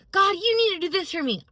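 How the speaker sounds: high, loud imitation of a demanding prayer; mock demand. Imagined prayer with an agenda in the car, mock demanding. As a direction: push the pitch up, insist like a pushy petitioner.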